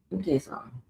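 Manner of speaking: soft repeat of let me tell you something. Real, not acted. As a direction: say it again under your breath.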